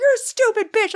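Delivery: high yelled insult; mock insult yell. The driver yells back at her, mock yell. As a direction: bark it up high as the rude driver. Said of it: "He's like".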